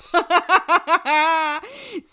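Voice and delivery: loud high burst of laughter; big laugh. Real, not acted. Laughs at the joke.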